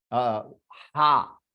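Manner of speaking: अ, हा drawn out slowly with a breathy हा; slow visarga demo. Shown as a demonstration. Teacher showing how visarga sounds after आ. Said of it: "तो विसर्ग will become".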